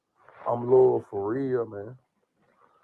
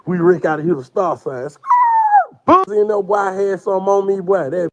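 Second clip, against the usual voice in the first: loud laughter running through the words; cracking up while talking, much louder; laughing loud; 8 semitones higher, 9 dB louder than usual. Real, not acted. Cracking up with his homeboy over jail stories, loud laughing.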